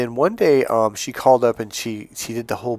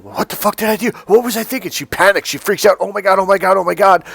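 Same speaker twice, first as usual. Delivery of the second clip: higher, louder hammed-up panic; mock panic; 9 semitones higher, 5 dB louder than usual. Coach acting out a woman panicking at home, mock panic. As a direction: act out her freaking out.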